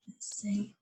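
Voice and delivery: soft unvoiced aside; whisper. Real, not acted. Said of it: "aside to children".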